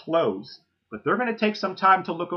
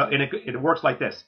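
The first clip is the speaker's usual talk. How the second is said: ordinary talk, nothing marked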